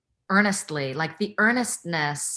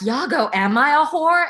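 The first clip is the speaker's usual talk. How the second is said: high, louder plaintive wail; mock her helpless pleading, pitched up; whiny Desdemona; 9 semitones higher, 7 dB louder than usual. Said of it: "Desdemona being like".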